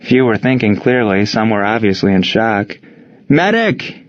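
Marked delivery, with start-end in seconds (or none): 3.3-4.0 high, falling call for a medic; urgent cry, raised in pitch only; screamed for help. A narrator's reading. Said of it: "someone screamed again".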